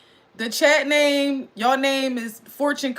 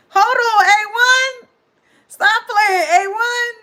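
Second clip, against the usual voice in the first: high, loud excited outburst; excited yelling; 10 semitones higher, 8 dB louder, slower than usual. Real, not acted. Excited streamer yelling at a viewer to stop playing, high and loud. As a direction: yell it out, pitched way up. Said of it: "I'm screaming. I'm sorry".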